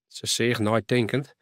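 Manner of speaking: usual speaking voice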